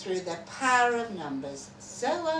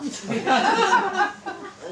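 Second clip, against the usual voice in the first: laugh; 10 semitones higher, 8 dB louder than usual. Acted. Reading a comic story.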